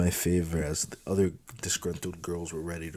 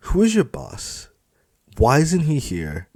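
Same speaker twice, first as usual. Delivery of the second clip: raised, insistent challenge; demanding heroine; 8 semitones higher, 6 dB louder than usual. Acted. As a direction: demand answers boldly. Rumia demands answers.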